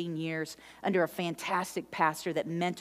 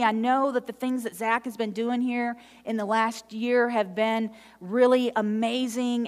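usual speaking voice

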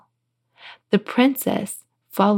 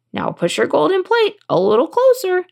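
high-pitched storybook frog; 10 semitones higher than usual. A narrator's reading. Frog at table wants princess's plate, high frog voice. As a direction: demand dinner as a frog, pitched way up. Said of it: "then he sat and said".

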